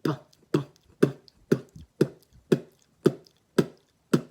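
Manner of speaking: slow bu bu, the vowel fading out; slow kick drill. Shown as a demonstration. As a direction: ease the vowel out beat by beat.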